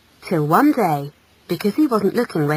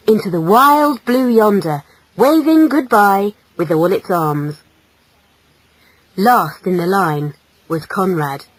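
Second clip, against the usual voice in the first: ordinary delivery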